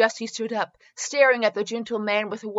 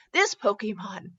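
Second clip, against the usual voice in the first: ordinary talk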